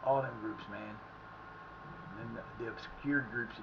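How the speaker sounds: normal talking voice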